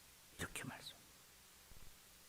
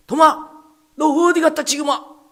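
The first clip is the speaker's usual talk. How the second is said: loud, higher call to Thomas; 11 semitones higher, 11 dB louder than usual. Retold or imitated. The disciples greeting the late Thomas, loud acted call. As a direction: shout across to a latecomer.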